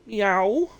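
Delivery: loud high cat meow. Pretend. Streamer giving in to chat with a cat meow. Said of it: "can't not do cat noises".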